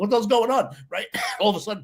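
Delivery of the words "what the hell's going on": baffled shoppers. Retold or imitated. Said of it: "People are like".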